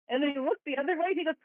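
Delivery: normal talking voice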